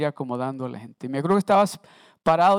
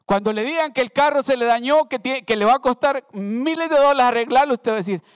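louder, rising preacher's build; full-force preaching; 8 semitones higher, 7 dB louder, faster than usual. Acted. Preacher rousing the church about hard times, loud and fervent. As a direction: drive it home to the congregation.

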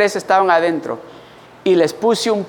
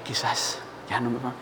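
hushed whisper, much softer; whispered hope; 10 semitones lower, 15 dB quieter than usual. Retold or imitated. Boy hoping the bullies are gone, whispered. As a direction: breathe it out in a whisper. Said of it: "Ya dije".

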